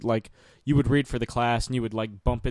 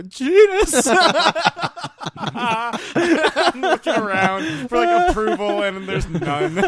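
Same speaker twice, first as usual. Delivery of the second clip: much higher, louder, very slow with laughter bubbling through; can't hold it together, giggle through the word; giggling; 13 semitones higher, 7 dB louder, slower than usual. Retold or imitated. Kid giggling at genus in class.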